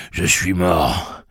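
exhausted and panting. A narrator's reading. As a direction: drag the words out, out of puff.

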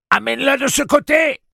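very high, loud yell; screamed order. A narrator's reading. Susanna struggling with the demon, ordering Eddie with a scream. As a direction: yell the command at the top of your range.

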